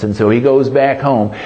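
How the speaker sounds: normal talking voice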